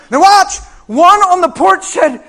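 loud, high fervent preaching; full-force preaching. Acted. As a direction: preach it out with all your fire.

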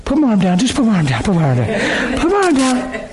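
rising laughing plea. Retold or imitated. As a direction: beg to put the arm down, laughing. Retold pleading, laughing.

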